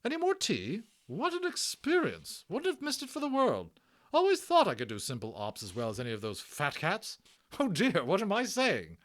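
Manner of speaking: high loud brisk nurse voice; a bustling hospital matron; hearty Matron. Acted. Matron reassuring Omri about the Indian, high and hearty.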